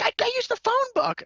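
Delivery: client's answer squeaked way up high; high, timid mock voice of the clueless client; mimicking the client. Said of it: "She's like".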